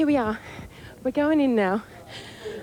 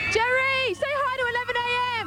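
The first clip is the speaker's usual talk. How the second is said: high-pitched hail to someone some way off; calling out; 6 semitones higher than usual. Real, not acted. Reporter hailing a pop star for a greeting, calling out. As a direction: call out high, stretching the name.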